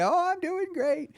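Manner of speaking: lightly voiced grandma, pleased to hear from her grandchild; sweet old grandma. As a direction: answer like a cheerful grandmother. Grandma answers FaceTime call.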